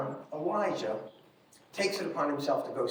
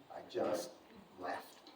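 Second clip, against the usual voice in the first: breathy whisper, fading to nothing; 9 semitones lower, 13 dB quieter, slower than usual. Real, not acted. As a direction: barely voice it, ashamed. Somber confession of leaving mother.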